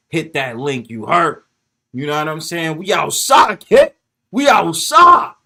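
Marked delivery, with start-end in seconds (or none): 2.8-5.3 loud, high hype cry, the last one slow; yelling a catchphrase to the stream; hype shout. Real, not acted. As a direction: shout it out to the chat.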